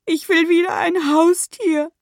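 put-on high-pitched child's whine; acted tearful, pleading lament; wailing. A narrator's reading. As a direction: wail it like an inconsolable little boy. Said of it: "jammerte er".

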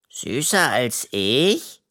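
slow, suspicious raccoon question; jealous Henry, slow and wary. A narrator's reading. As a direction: drag it out with mistrust. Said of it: "fragt Henry misstrauisch".

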